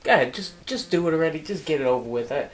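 soft, low, defeated grumble; weary, subdued and low; resigned dare. Acted. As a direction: give up quietly and dare them. Richie gives up, invites the punch.

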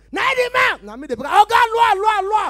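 high, full-blast comic shouting; Akpororo shouting. Acted. Comedian finding he now shouts like Akpororo, full-blast shout. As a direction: scream it at the top of the voice. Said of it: "how they shout like, uh, uh, like Akpororo".

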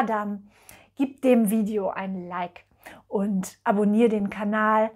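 usual speaking voice

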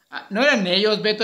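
normal talking voice

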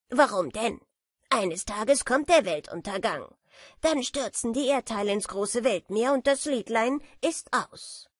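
a smug know-it-all beetle teasing; lightly voiced boastful beetle; proud beetle. A narrator's reading. Beetle dismisses her fear.

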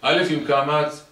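loud, high, drawn-out chant; slow sing-song alphabet drill; teacher drilling child. Acted. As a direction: hammer the letter lesson into a child. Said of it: "אתה אומר לו".

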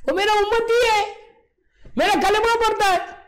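high, loud imploring; loud pleading. Acted. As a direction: beg God to tell. Moses pleads for his follower.

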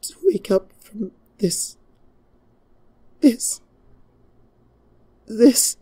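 whispered, then cracking up into high desperate cries; broken whisper. Acted. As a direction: fall apart on the repeats. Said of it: "her voice broke".

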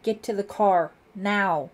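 loud, forceful command; screamed order. A narrator's reading. Lieutenant repeats order to girls, loud shout. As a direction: shout it at them urgently. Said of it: "screamed at the girls".

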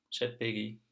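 ordinary delivery

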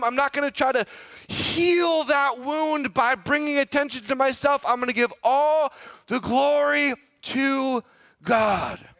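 high, loud, building preached refusal of pride; preaching at full force. Retold or imitated. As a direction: give God the glory, shout it out.

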